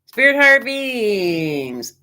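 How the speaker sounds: slow, solemn calling on the spirits; hushed ritual invocation for the reading; tarot invocation. Acted. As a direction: invoke the cards like a small ritual.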